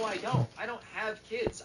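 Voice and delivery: high-pitched, indignant retort; mock exasperation. Cousin argues back at his sister, mock exasperation.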